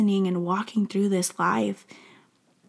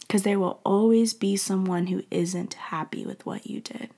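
ordinary delivery

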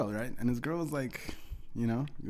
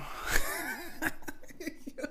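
long, high helpless laugh. Real, not acted. Comic cracking up at his buddy impression, high laughter.

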